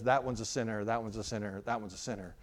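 low voice sinking into quiet; accusation fading away. Acted. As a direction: let the devil's accusation die away. Devil's accusation repeated, dying away.

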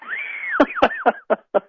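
a quick high laugh. Real, not acted. Laughs after the teasing.

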